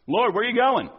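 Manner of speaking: high, loud pleading question; Peter asking. Acted. Peter's question voiced in sermon.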